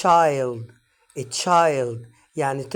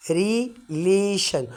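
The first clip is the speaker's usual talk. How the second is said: relation drawn out slowly, syllable by syllable; relation, each syllable spoken slowly and clearly; 3 semitones higher, much slower than usual. Shown as a demonstration. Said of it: "هاخدها على كذا مقطع". (relation ببطء شديد)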